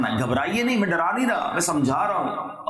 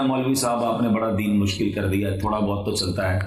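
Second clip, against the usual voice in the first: lower, lightly voiced careless layman; people excusing themselves; 7 semitones lower than usual. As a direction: mimic the casual excuse-maker, lower. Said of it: "لوگ کہتے ہیں". (بے پروا لوگوں کی ہلکی نقل)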